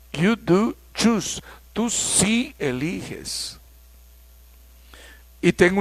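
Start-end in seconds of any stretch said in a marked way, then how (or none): 0.1-3.6 slow, with "do" and "sí" stressed; emphatic do. Shown as a demonstration. Teacher modeling the emphatic voice for students. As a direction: slowly insist on the point. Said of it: "sino voz enfática".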